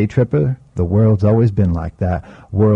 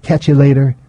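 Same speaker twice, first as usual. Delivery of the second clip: louder; 5 semitones higher, 4 dB louder than usual. Acted. Parting words quoted.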